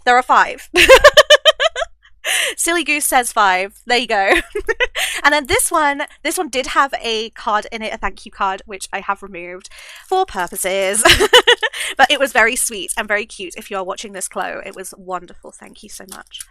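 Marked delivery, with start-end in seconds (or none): 0.7-1.9 high, loud giggling; long burst of high laughter; giggle. Real, not acted. Laughs at own mistake. 4.4-5.2 short high giggle; quick giggle at your own silliness. Real, not acted. Laughs at own mistake. 11.1-11.9 high, loud giggle; quick burst of high laughter. Real, not acted. Laughs after vague explanation.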